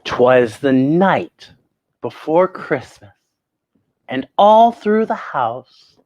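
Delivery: put-on lilting read-aloud to children; storytelling poem reading. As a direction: read it like a cozy Christmas Eve story. Reads Christmas poem aloud.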